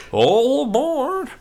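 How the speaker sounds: slow, high, loud all aboard; conductor call. Shown as a demonstration. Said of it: "But, but in Dutch".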